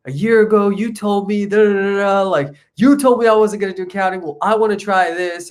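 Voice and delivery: high, strained mock-frantic phone plea; panicked freak-out. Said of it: "having a panic attack. I'm crying. I'm freaking out".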